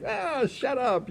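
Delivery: high, drawn-out dismissal without volume; mock mad. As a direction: imitate an irritated rival brushing someone off. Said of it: "he was all mad".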